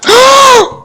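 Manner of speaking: sudden high-pitched yelp of fright; startled high yelp. Real, not acted. Yelps at the big enemy.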